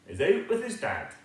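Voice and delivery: usual speaking voice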